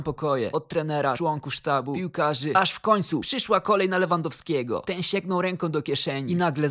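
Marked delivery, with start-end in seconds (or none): none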